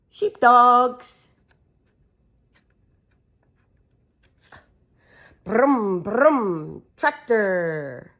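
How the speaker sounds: lively sing-song picture-book reading; reading to children. Acted. Mum reading a farm book to her kids, animated. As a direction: read it out brightly for little ones.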